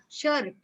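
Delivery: "shirt" as SHUR-it with a spoken R; R sounded. Retold or imitated. Teacher quotes a common form, R sounded. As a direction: imitate people sounding the R in "shirt". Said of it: "शर्ट भी बोलते हैं बहुत सारे लोग".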